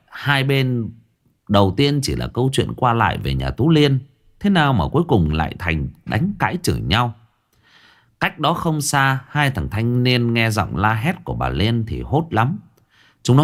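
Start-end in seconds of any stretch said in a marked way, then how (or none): none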